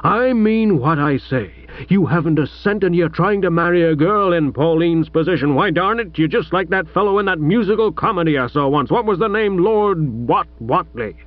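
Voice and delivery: lightly voiced gruff American father; a hint of an overbearing American millionaire; American tycoon father.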